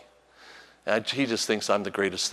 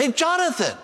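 calling out. Retold or imitated. Grandpa hailing his running grandson, calling out high. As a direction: yell a name to get attention.

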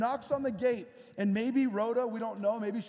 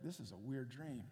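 confused mumble; 8 semitones lower, 14 dB quieter than usual. Retold or imitated. Peter confused in retold story.

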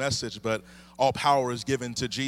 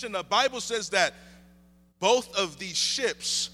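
higher, lifted preaching voice; 9 semitones higher than usual. Acted. Pastor preaching to the church, lifted and higher. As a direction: preach it out to the congregation.

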